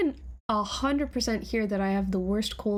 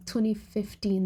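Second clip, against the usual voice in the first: normal talking voice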